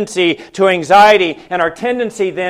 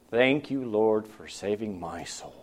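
very soft, much lower murmured thanks; quiet prayer; 10 semitones lower, 13 dB quieter than usual. Real, not acted. Preacher turning to God mid-sermon, hushed thanks. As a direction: breathe it out as a private prayer.

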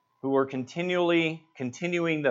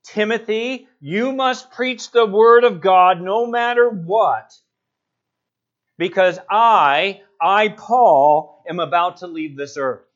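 loud, raised plea punching "you" and "I"; emphatic charge as Paul; 3 semitones higher, 6 dB louder than usual. Acted. Paul charging Timothy before leaving, urgent and emphatic. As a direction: hit "I, Paul" hard, then ease down on "am about to leave". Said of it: "You see the emphasis here?".

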